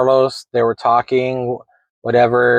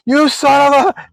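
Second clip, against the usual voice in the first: retold yell; 11 semitones higher than usual. Forgotten friend yelling at the departing car, high mock yell. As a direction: belt it out like a friend who got forgotten.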